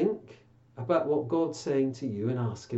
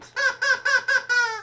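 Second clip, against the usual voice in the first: very high squeaky puppet cackle; a bird puppet's shrill chuckle; high cartoon bird laugh; puppet squawk laugh; 3 dB louder than usual.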